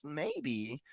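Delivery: soft, shrugging "Maybe."; calm farmer. Retold or imitated. The farmer shrugging off the neighbors, soft and calm. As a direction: barely say it, unbothered. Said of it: "And he goes".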